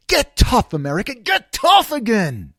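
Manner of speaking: loud slogan, slowed on the repeat; loud mock-patriotic call to arms; rally cry. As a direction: bellow it like a campaign chant.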